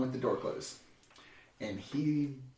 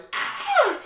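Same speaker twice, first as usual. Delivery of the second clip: high mock shriek; hammed-up scream of alarm; panic scream; 5 dB louder than usual. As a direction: imitate everyone freaking out. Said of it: "when everyone else is like".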